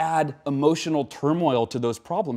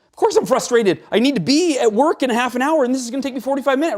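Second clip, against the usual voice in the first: higher, louder mock frustrated rant; mock frustrated outburst; 12 semitones higher, 6 dB louder than usual. Imitating a stressed driver stuck in traffic, higher and louder mock frustration. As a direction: blow up over traffic like a stressed commuter. People justifying their stress.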